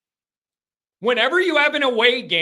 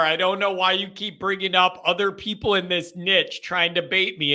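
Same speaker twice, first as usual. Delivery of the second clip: ordinary delivery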